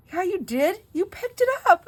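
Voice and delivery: loud, high-pitched accusation; mock child's indignant protest; indignant child, imitated. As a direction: fire back like an outraged kid. Child accusing in a retold quarrel.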